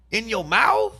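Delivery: high, loud and aghast; shocked disbelief. Real, not acted. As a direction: blurt it out scandalized. Repeating shocking detail in disbelief.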